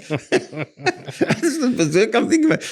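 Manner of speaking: laughing in disbelief while speaking. Real, not acted. Businessman laughing at his own unbelievable deal, laughing slowly. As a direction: talk through a slow real laugh, pitch up high. Tells an amazing property story.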